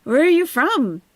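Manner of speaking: high, loud put-on questions; mocking the streamer. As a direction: quiz them in a mock-eager high voice. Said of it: "what was she gonna say".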